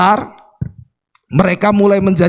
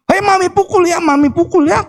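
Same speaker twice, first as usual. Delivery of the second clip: much higher-pitched mock maternal threat; imitated threatening mother; 11 semitones higher than usual. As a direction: warn the child in a high, scolding voice. Voices a mother threatening her child. (tiruan ibu mengancam dengan nada tinggi)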